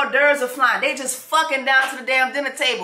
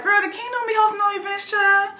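high, fast sassy voice; sassy mock servant; 9 semitones higher than usual. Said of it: "And Brimsey was like".

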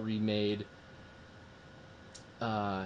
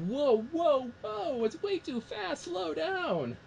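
much higher, slow, mock alarmed whoa; overwhelmed viewer; much slower than usual. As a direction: plead with the film to slow down. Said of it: "I was like".

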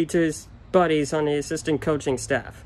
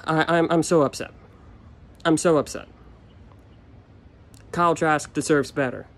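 ordinary talk

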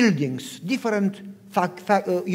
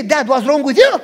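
loud, raised-pitch comeback; acted-out indignant son, loud and high; exasperated retort; 6 semitones higher, 11 dB louder than usual. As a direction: throw the question back, shouting. Retort he was ready to say.

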